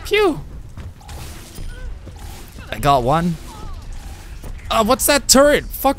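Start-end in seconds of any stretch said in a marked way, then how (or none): 0.1-0.4 1 high mock gun pew. Real, not acted. Gun sound effect.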